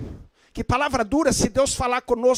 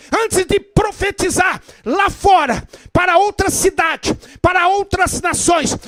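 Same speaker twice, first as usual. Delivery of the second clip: loud, rousing preacher's call; preaching at full force; 6 semitones higher, 5 dB louder than usual. Acted. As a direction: preach it out to the whole church. Preacher exhorts the congregation.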